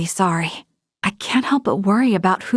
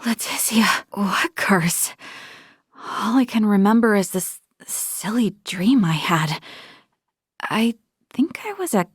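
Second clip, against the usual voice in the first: dazed, hushed whisper. Real, not acted. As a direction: murmur as if just waking up. Waking dazed from a dream.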